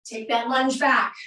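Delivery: ordinary talk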